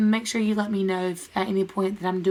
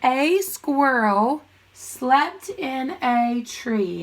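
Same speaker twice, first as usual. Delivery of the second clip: very slow, word-by-word reading; drawn-out read-along for first graders; read-along pace; much slower than usual. Shown as a demonstration. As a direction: stretch each word so a class can join in. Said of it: "Read it with me".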